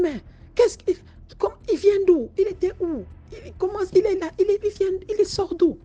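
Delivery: high, crying panic; frantic, near tears. Acted. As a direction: demand where he came from, terrified. (panique en larmes, voix aiguë)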